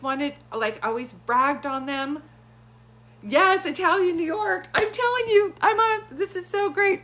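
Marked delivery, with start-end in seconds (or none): 3.2-7.0 high, loud burst of delight; excited. Real, not acted. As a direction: blurt it out, thrilled to be right. Delighted her accent guess was right.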